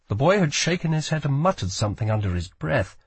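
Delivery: usual speaking voice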